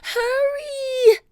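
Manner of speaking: high, loud urgent call; high-pitched, drawn-out shout; calling back. A narrator's reading. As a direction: holler it high to someone lagging behind. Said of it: "Annie called back to him".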